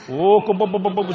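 rapid babbled mock tongues; praying in tongues. Wife panicking in the truck, imitated praying in tongues. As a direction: imitate frantic praying in nonsense syllables. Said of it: "she started praying in the Holy Ghost".

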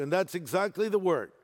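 ordinary delivery

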